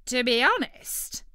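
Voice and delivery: turkey voice. Acted.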